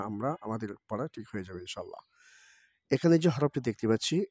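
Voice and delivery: ordinary talk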